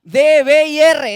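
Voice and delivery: D, B y R said slow and loud, letter by letter; spelled out slowly. Shown as a demonstration. Preacher teaching the Hebrew letters, slow and loud. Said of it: "spelling letters".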